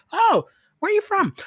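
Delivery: high, excited server voice; curious server. Acted. Server asking a foreign customer's origin, high curious voice.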